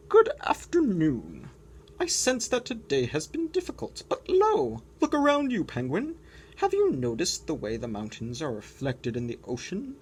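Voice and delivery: grand, solemn lightly voiced walrus; wise walrus. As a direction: counsel the sad penguin kindly. Wise walrus speaks to penguin.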